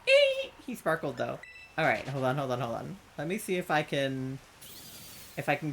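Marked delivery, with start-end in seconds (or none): 0.1-0.5 delighted high-pitched squeal. Real, not acted. Sees sparkle.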